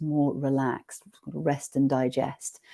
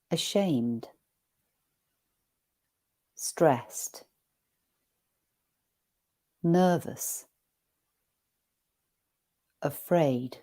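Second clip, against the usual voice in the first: each word read flat and even, with long pauses; neutral reading voice; slower than usual. Shown as a demonstration. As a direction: read the list slowly and neutrally, letting each word land. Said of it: "I'm gonna read it in a neutral voice".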